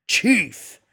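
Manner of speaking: loud, high shout. A narrator's reading. Dingo repeats himself to the kid, yelling. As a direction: shout it hard and high on one word. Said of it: "repeats it louder".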